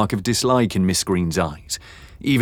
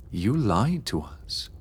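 quiet, slow, hurt reproach; soft; 2 semitones higher, 6 dB quieter, slower than usual. A narrator's reading. Beatrix quietly accusing James of lying to them. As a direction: say it softly, almost under the breath. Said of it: "Beatrix's voice was soft".

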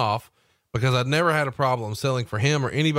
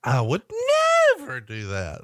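hammed-up squeaky denial; mock innocence, pitch soaring mid-phrase; voice going up. Shown as a demonstration. Host imitating someone full of it, squeaky fake denial. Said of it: "when their voice goes up".

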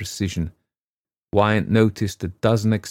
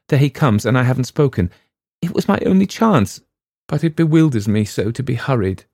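higher, louder panic; startled girl; 6 semitones higher, 5 dB louder than usual. A narrator's reading. Girl hearing a sound, panicked. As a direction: blurt in alarm.

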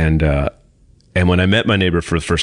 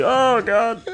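dismayed groan; 14 semitones higher than usual. Retold or imitated. Host's retold reaction to the neighbor.

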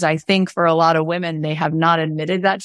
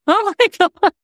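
much higher, laughing squeal of delight; overjoyed; 13 semitones higher, 4 dB louder than usual. Retold or imitated. Wife reacting to her husband's generous offer, much higher laughing squeal. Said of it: "And I was like".

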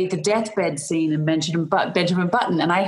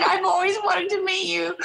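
much higher, put-on frail old lady greeting; old woman voice; 14 semitones higher than usual. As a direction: play a sweet old lady meeting her idol. This old woman had got past.

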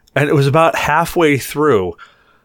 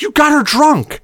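higher, blaming mock bartender; accusing bartender. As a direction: accuse him outright. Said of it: "She's like".

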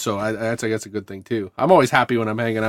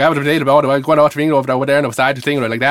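put-on Newfoundland accent; 2 semitones higher, 4 dB louder, faster than usual. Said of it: "turns into gibberish".

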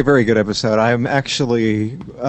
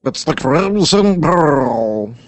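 dramatic voice; 5 semitones higher, much slower than usual. Acted. Co-host answers with own voice.

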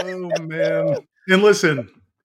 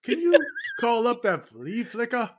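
higher, calling across the stands to the coach; 6 semitones higher, slower than usual. Pretend. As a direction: shout it up to Coach Norvell like a hopeful fan.

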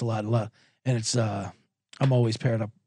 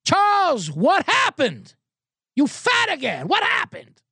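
put-on yelling Korean accent; 11 semitones higher, slower than usual. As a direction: yell the blunt insult like an old golf buddy. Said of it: "He yells".

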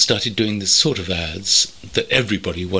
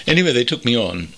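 usual speaking voice

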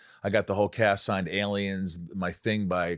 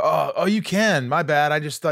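high, loud, flustered apology; hurried apologetic yelp; 8 semitones higher, 5 dB louder than usual. Retold or imitated. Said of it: "I go".

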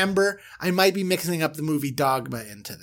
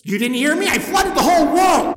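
God shouting; 12 semitones higher, 8 dB louder than usual. Retold or imitated. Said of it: "And he goes".

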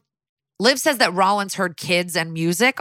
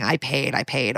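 ordinary talk, nothing marked

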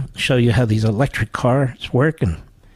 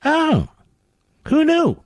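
sarcastic surprise; 13 semitones higher, slower than usual. Real, not acted.

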